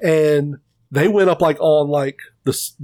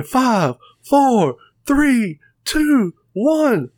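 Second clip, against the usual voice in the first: countdown climbing higher with each number; mock countdown; 8 semitones higher, much slower than usual. Retelling an entrance to a friend, hyped mock countdown. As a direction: call out the arena countdown like the crowd, rising in pitch.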